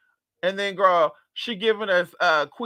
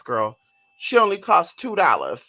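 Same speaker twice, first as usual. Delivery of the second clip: ordinary talk, nothing marked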